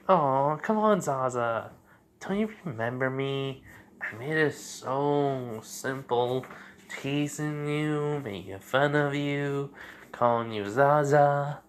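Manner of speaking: slow, drawn-out coaxing tease; playful teasing. Acted. Woman to Zabuza, reminding him of old times, playful teasing. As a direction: stretch the words out in a teasing lilt.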